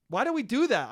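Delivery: ordinary delivery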